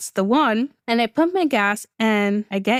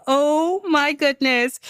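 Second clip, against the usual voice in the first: slow, drawn-out acted amazement; retold awe; 3 semitones higher, much slower than usual. Guest seeing the valley view, slow retold awe. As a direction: stretch it out as if stunned.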